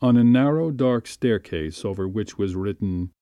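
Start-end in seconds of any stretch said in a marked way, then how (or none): none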